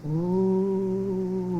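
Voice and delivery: long sustained "ooh"; steady wind howl; wind moan. A narrator's reading. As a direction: imitate the wind in one held note. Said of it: "he roared".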